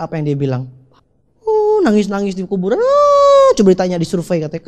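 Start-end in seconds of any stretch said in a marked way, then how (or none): none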